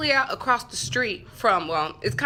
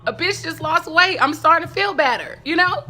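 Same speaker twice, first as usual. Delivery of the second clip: hammed-up pleading prayer, growing louder and higher; panicked pleading; 5 semitones higher, 7 dB louder than usual. Begging Jesus during a police stop, mock-desperate pleading. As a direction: plead for your life, playing up the panic.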